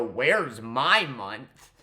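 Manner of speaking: slow, drawn-out whiny mock complaint; mocking whine. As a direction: stretch it out like a sulking child. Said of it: "to sit here and go".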